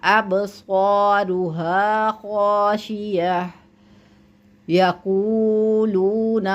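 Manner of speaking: slow, drawn-out Quranic recitation. Shown as a demonstration. Teacher recites the verse slowly for the student. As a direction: chant each syllable long and measured.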